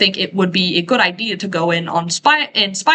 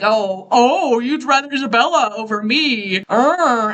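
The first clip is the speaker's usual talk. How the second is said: higher, whiny mock jealousy; mock petty; 3 semitones higher than usual. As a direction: imitate a petty, put-out lover. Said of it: "Cathy is very petty about this".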